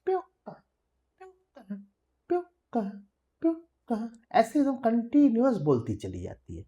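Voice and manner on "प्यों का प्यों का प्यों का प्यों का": high bird cry, repeated; पपीहे की ऊँची पुकार; papiha bird call. Shown as a demonstration. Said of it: "उसकी आवाज ऐसी सुनाई देती है जैसे".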